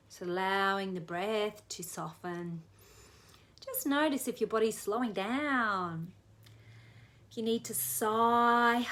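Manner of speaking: hushed relaxation coaching; guided relaxation. Acted. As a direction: ease them into calm.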